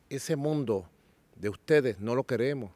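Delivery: usual speaking voice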